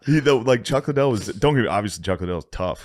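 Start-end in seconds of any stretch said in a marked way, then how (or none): none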